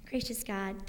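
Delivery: normal talking voice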